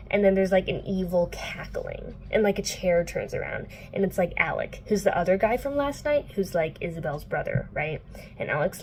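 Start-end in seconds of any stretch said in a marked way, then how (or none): none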